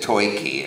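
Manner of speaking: "Toiki" with an OI in the first syllable; mistaken OI vowel. Shown as a demonstration. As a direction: say "Toiki" like "toy-key". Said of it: "literated O I as if he said".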